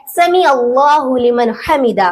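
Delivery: "समी अल्लाहु" with ain, no y glide; correct recitation. Shown as a demonstration. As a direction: model "अल्लाहु" cleanly after "समी". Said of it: "सही तरीका है". ("समी अल्लाहु" में "ऐन" साफ़)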